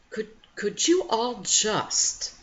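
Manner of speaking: loud, slow, exasperated plea; slowed-down loud irritated plea; irritated outburst. Retold or imitated. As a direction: snap at the whole household in mock frustration. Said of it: "at first I got really irritated".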